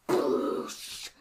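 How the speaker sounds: loud, breath-driven whoosh of a blow; imitated impact noise. Highlander strikes the fallen orc, imitated loud impact. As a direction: blast out a crashing hit noise.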